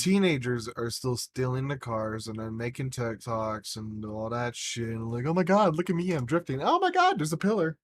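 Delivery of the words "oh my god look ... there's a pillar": high, loud hammed-up thrill turning to alarm; mocking teen drivers. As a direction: imitate a thrilled kid about to crash. Mocking teenagers crashing while drifting.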